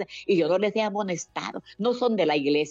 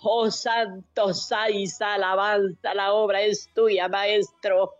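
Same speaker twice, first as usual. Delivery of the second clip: rising, pleading prayer with tearful catches; fervent prayer; 5 semitones higher, slower than usual. Acted. Woman praying for the suffering and the jailed, tearful and pleading. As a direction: pour it out to God, voice near breaking. Leads a long intercessory prayer.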